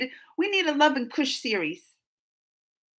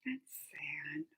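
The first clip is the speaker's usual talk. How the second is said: hushed sadness; 19 dB quieter than usual. Real, not acted. Sad about Sita leaving.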